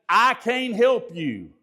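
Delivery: high, slow, drawn-out refusal; mock unhelpful refusal. Shown as a demonstration. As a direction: demonstrate a tactless brush-off, pitched high. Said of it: "Instead of saying".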